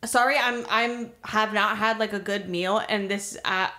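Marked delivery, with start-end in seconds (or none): none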